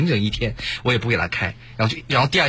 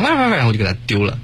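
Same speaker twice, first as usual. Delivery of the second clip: ordinary delivery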